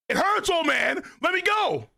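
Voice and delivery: high-pitched cartoon wail; hammed-up cry to be released; pained yelling. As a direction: yell in protest, high and whining. Acts out Luffy being grabbed.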